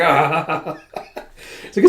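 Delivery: acted burst of laughter; theatrical "ah-ha-ha"; mock laugh. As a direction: laugh off the warning as the character. Man laughs mockingly at her warning.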